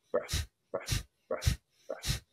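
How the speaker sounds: quiet, unvoiced, popping exhales; sharp nose exhales. Shown as a demonstration. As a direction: blow each one out hard. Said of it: "Keep doing that".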